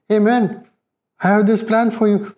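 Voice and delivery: lightly voiced God, pitched high; God's voice. God answering a praying couple, lightly voiced and high. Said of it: "you hear his voice say".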